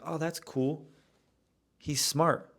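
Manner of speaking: lightly voiced admiration, hushed and lifted; imagined impressed barista. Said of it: "She would have been like".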